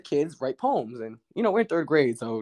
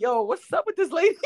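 put-on 1 incredulous kid. Kid reacting to teacher.

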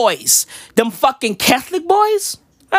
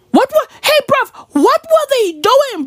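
exasperated; 12 semitones higher than usual. Acted. Outraged rant, pitch way up.

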